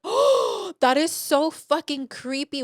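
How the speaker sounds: appalled outburst. Retold or imitated. Learning the neighbor sees in, high and appalled. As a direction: recoil in disgust at the creepiness.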